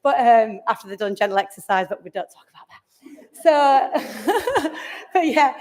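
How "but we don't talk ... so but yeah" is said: slow, whispered aside through a laugh; a slow, hushed confession with a chuckle. Real, not acted. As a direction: let it slip out as a giggly secret. Joking aside about the biscuits.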